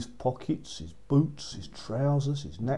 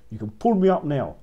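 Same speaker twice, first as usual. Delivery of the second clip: louder call up the hollow tree; 2 semitones higher, 8 dB louder than usual. A narrator's reading. Soldier calls up to the witch, loud. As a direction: shout it up to someone far above.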